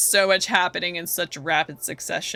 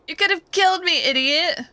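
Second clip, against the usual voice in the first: high, loud, hammed-up outrage; mock fury; 9 semitones higher, 3 dB louder than usual. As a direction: snap at the clumsy ringmaster. Girl knocked over.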